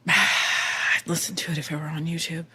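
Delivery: quiet, breath-heavy mock reluctance; imitated reluctant sigh. Imitating a tattoo artist friend declining the show, quiet sighing voice.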